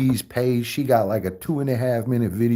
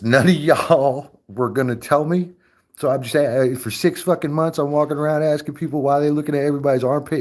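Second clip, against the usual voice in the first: normal talking voice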